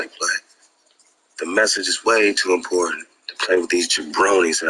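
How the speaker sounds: loud, driving preacher-like rant; a fiery, loud sermon to the audience; fired-up rant. Real, not acted. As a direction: hammer the message home to the viewers. Impassioned rant, much louder.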